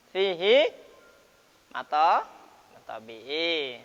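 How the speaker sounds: matobi'i stretched slowly; recited term. Shown as a demonstration. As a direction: spell out fihi and matobi'i like reciting. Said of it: "saya rasa kalau udah dijelaskan".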